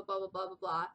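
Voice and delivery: normal talking voice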